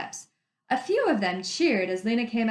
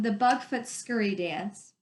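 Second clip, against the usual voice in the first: ordinary delivery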